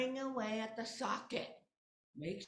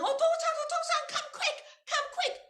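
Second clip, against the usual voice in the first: high, loud desperate calls; yelling for father; 10 dB louder than usual. Acted. Daughter calling father to come quick, loud and high. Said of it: "Mariko is yelling".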